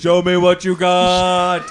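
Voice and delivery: loud drawn-out taunt; loud slow challenge. Pretend. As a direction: call it out slowly and loud. Alien rap battle challenge.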